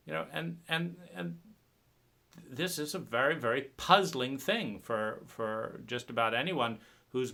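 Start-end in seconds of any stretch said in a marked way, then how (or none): none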